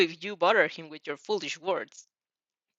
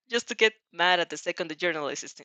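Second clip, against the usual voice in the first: usual speaking voice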